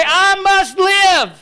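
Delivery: slow, loud, high shout of I must live; shouted call. Shown as a demonstration. Preacher rousing the congregation, slow shout. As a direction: cry it out slowly for all to repeat. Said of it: "say it with me".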